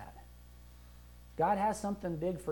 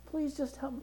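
much softer, higher pleading voice; begging prayer; 6 semitones higher, 8 dB quieter than usual. Retold or imitated. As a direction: beg pitifully like a beggar. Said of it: "We don't have to beg the Father".

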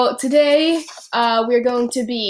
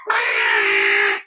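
duck voice squawk; 9 semitones higher than usual. Pretend. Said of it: "I need to put a volume warning".

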